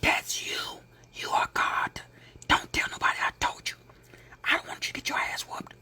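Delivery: hushed secret whisper; conspiratorial whisper. Real, not acted. Preacher letting the congregation in on a secret, whispered.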